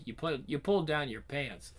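plain reading voice

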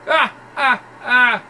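high loud mocking cackle. Thug cackling at Brian's exterminator, high and loud. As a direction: cackle to ridicule the old man. Mocking laugh at big man.

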